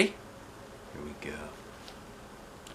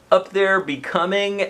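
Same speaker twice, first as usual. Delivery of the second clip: ordinary delivery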